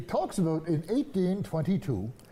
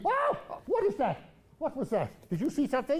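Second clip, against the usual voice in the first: startled; 9 semitones higher than usual. Acted. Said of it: "It doesn't mean".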